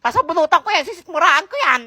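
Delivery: usual speaking voice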